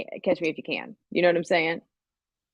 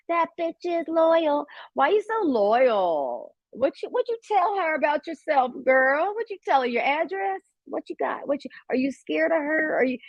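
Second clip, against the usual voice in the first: mocking high voice; 8 semitones higher than usual. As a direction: taunt them in a high sneering voice. Mocking [name]'s followers.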